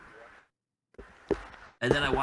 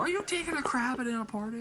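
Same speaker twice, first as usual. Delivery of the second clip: high-pitched mock disbelief; imitated party member; 12 semitones higher than usual. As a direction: imitate an incredulous friend in a high voice. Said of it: "They go".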